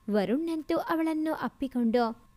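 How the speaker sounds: ordinary delivery, nothing marked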